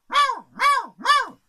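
very high, loud meows; cat meowing. Acted. Cat meowing at the sleeping rooster, high and loud. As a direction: meow loudly to wake him. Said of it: "Fue el gato y le dijo".

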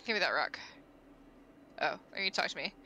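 usual speaking voice

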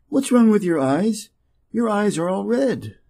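usual speaking voice

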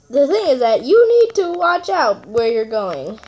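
ordinary delivery

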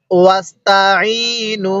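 ওয়াস্তাইনু drawn out slowly, each sound shaped with care; slow Quran recitation. Shown as a demonstration. Teacher showing students how to read the verse, slow careful recitation. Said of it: "কিভাবে পড়ব?".

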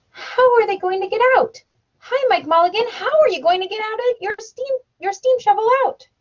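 high, loud calls down into the cellar; crowd calling down. A narrator's reading. Everybody calling Mike Mulligan in the cellar, high loud calls. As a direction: shout down to the digger.

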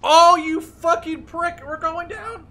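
very high, loud, slowed howl of rage; furious despair. Real, not acted. Pilot shot down, howling at the enemy in fury. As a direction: rage as the plane falls.

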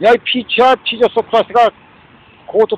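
loud, contemptuous outburst; raging at the listeners; angry yell. Real, not acted. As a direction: hurl the insult loudly. Angry rant, insulting listeners.

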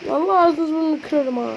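ordinary talk, nothing marked

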